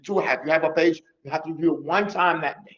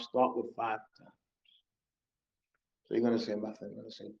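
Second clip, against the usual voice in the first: ordinary delivery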